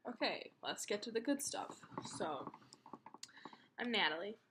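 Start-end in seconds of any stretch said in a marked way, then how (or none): none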